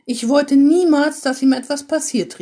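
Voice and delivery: hoch und laut, flehend gerufen; stöhnend gerufen. Acted. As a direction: verzweifelte Mutter ruft beteuernd, höher. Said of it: "rief sie stöhnend". (die Mutter beteuert laut und stöhnend ihre Liebe zum Sohn)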